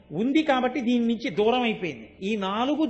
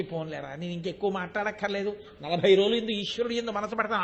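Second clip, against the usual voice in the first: lower, much softer, voice nearly gone; lost voice; 4 semitones lower, 6 dB quieter than usual. Retold or imitated. Pilgrim with a lost voice brushing off a friend, near voiceless. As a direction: speak as if the throat is spent. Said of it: "కంఠం పోయి".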